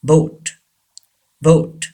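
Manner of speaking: BOAT with a long "oh"; British pronunciation. Shown as a demonstration. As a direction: model "boat" as BOHT. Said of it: "but Britishers". (boat को "बोट" बोलें)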